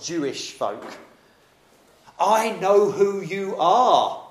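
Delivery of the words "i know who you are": slow, drawn-out, high and louder, I know who you are; slow menacing spirit. Acted. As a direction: stretch every word to unsettle him. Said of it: "The spirit is trying to intimidate Jesus".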